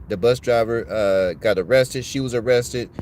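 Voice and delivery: ordinary talk, nothing marked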